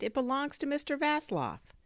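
high-pitched, insistent explanation; pleading neighbour. A narrator's reading. Neighbour explaining whose drone it is, high pleading.

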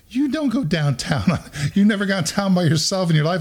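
half-laughing mock protest at a son's tall tale; incredulous dad. Dad mocks son's downtown story.

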